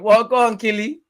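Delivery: high, loud cheering egging him on; excited cheer. Real, not acted. Reacting to Son's tears, egging the player on with an excited cheer.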